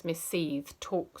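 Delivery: ordinary delivery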